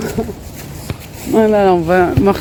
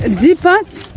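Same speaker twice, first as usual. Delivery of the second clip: higher coaching voice; 7 semitones higher than usual. Pretend. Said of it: "ça veut rien dire".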